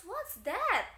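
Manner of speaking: teacher modelling a curious reply; curious question. Shown as a demonstration. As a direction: ask with sudden curiosity.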